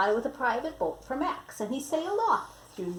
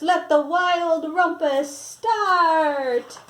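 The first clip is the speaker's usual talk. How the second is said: loud, higher, slow decree; royal cry; 6 semitones higher, 8 dB louder, much slower than usual. Acted. Max starting the rumpus, loud slow proclamation. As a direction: drag it out like a grand king. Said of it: "cried Max".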